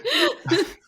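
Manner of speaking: éclat de rire très aigu. Real, not acted. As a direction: rire qui monte haut. Éclate de rire après la blague. (fou rire aigu)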